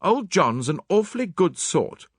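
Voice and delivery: high, emphatic defence; blurted out. A narrator's reading. Hastings defends John to Mary, emphatic blurt. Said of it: "and I blurted out".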